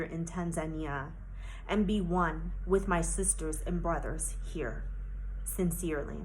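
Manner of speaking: grave, unhurried appeal; solemn letter reading. Real, not acted.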